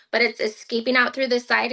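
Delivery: usual speaking voice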